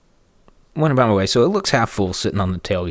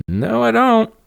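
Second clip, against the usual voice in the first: snarky retort; 14 semitones higher, 4 dB louder, slower than usual. Retold or imitated. He snaps back at neighbor.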